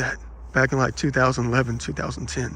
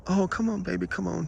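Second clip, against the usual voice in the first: higher, softer coaxing of a pet; gentle mock pet-talk; dog owner coaxing; 3 semitones higher, 5 dB quieter than usual. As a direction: lightly imitate an owner calling her dog. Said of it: "talking to your dog".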